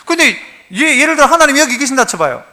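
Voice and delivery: ordinary talk, nothing marked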